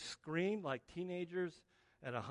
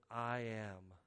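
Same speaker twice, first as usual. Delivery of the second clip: ordinary talk, nothing marked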